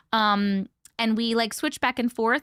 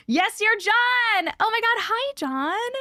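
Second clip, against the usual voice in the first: excited greeting; 12 semitones higher, 3 dB louder, slower than usual. Real, not acted. Excited greeting to chat viewer.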